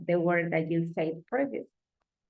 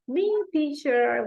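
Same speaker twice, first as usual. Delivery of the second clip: eager pupil with hand up, pitched higher; eager student; 8 semitones higher than usual. Retold or imitated. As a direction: beg to be picked. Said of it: "with this face like".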